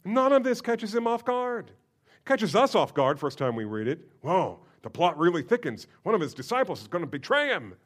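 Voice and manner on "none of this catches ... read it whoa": ordinary delivery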